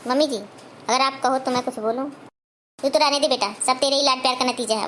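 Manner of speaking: normal talking voice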